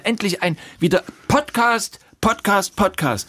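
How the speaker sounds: high, gleeful repeating; excited. Real, not acted. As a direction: chant the word with glee. Excited repetition of Podcast.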